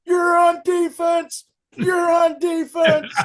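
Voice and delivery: high, loud, slow shouted order; furious coach. Retold or imitated. Coach banishing the player from offense, slow furious shout. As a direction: yell it, jabbing at the sky. Angry coach points, sends him off.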